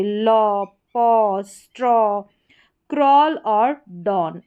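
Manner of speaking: slow word list, each aw drawn out; AW sound. Shown as a demonstration. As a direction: stretch the aw in "लॉ, पॉ, स्ट्रॉ, क्रॉल, डॉन". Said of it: "उच्चारण औ".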